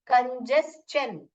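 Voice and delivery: कंजेस्शन said slowly, ending in a clear "chen"; कंजेस्शन, slow teacher demo; slow pronunciation demo. Shown as a demonstration. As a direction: spell out कंजेस्शन as kon-jes-chen with the "ch" sounded. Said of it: "चेन करके पढ़ते हैं".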